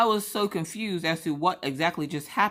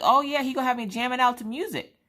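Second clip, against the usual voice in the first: excited; 8 semitones higher, faster than usual. Retold or imitated. Excited about headphones.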